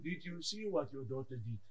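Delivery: low, soft grumbling to God; low soft tattling prayer; quiet complaint. Retold or imitated. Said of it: "I complain to him".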